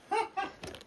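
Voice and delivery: quick high laugh at own joke. Real, not acted. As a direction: laugh at your own pun.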